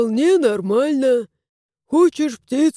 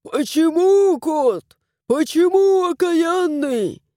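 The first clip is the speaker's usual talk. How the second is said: higher, drawn-out mock wail of reproach; mock yell, pitched up and stretched; retold cry; 6 semitones higher, slower than usual. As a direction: imitate someone crying out at a cat, without full volume. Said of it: "а он кричал".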